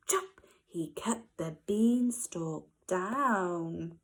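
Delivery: ordinary delivery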